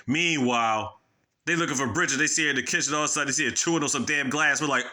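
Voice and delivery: ordinary talk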